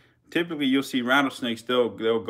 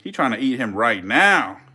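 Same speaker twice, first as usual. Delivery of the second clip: ordinary talk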